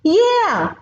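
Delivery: high piped-up yeah; piping warthog. Acted. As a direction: chime in high from behind the buffalo. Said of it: "piped up the warthog".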